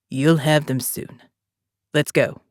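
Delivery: put-on calm commander, a light South African accent; faint South African accent. A narrator's reading. Jake speaks to Morgan.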